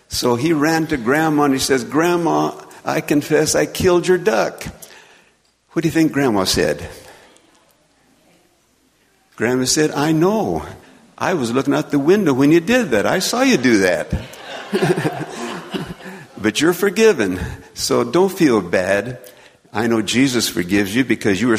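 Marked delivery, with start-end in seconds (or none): 1.8-4.6 put-on a higher pleading boy's voice; earnest little boy owning up, pitched higher; boy confessing. Little Bill confesses to Grandma about her duck, higher boyish plea. 9.9-19.2 put-on higher, kindly old grandma voice; a grandma who saw it all and forgives; gentle grandma. Grandma tells Bill she saw him and forgives, higher and gentle.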